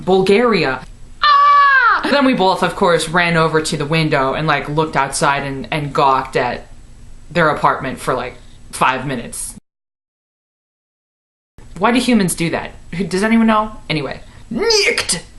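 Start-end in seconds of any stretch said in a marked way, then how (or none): 1.2-2.0 shocked shriek. Acted. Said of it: "shocked gasp reaction". 14.5-15.2 nicked. Acted. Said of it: "sign-off punchline".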